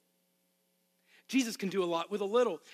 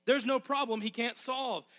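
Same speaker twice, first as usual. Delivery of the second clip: ordinary talk, nothing marked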